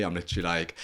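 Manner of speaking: ordinary delivery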